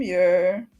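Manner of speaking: ordinary talk, nothing marked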